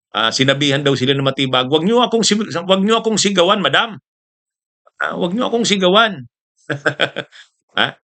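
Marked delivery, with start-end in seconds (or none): 1.7-6.3 higher-pitched mock protest; retold raised voice. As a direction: mildly imitate an indignant telling-off, pitched up. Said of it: "si Matibag nagtaas yun ng boses".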